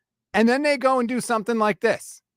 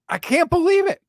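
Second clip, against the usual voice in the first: exasperated disbelief; 7 semitones higher, 4 dB louder than usual. Real, not acted. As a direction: pitch jumps up in shocked disbelief. Stunned at contract news.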